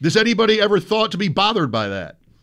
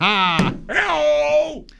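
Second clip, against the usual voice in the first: called greeting; 11 semitones higher than usual. Acted. Greeting a studio guest.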